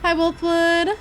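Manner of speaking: loud, high, thrilled hello; excited greeting. Real, not acted. Gamer thrilled to see a character, loud excited greeting. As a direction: burst out with a happy hi.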